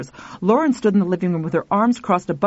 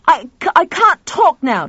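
louder, higher stammer; hurried stammered brush-off; flustered; 9 semitones higher, 6 dB louder than usual. Acted. Tuffy cutting off the caller, loud fluster. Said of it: "Tuffy said".

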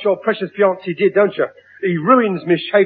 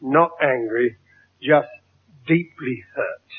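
softer, slow self-pitying sigh of a line; wounded martyr; 4 semitones lower, 5 dB quieter, slower than usual. Acted. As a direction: sound deeply hurt, milking the sympathy. Father plays the wounded martyr.

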